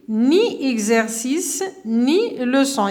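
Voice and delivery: very slow, word by word; slow repeat. Shown as a demonstration. Teacher repeating the example for pupils, very slowly. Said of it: "سلمى n'écrit".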